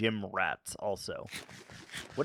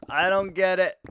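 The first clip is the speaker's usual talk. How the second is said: higher and louder, DON'T hit hard; loud baffled protest; exasperated outburst; 7 semitones higher, 8 dB louder than usual. Real, not acted. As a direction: blurt it out in disbelief.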